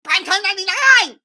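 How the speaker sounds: shrieking mocking impression, high and loud; Alex Stein. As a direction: screech it like a deranged caricature. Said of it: "Here's my impression".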